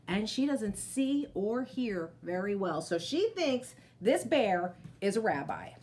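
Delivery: plain reading voice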